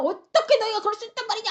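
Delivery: fast, high-pitched scolding; acted angry outburst; thundering rebuke. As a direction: fire off an outraged accusation. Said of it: "막 호통을 치니까".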